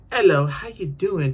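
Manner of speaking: a snooty put-on British accent; a hammed-up British accent with a spritz of cologne; mock British accent. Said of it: "a U K British accent".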